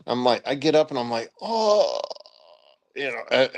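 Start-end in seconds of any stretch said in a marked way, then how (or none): 1.4-2.0 high, drawn-out groan of pain; winded groan. Retold or imitated. Jumper getting up from bad landing, groaning. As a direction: re-enact groaning after a hard fall. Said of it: "knocked the wind out of me".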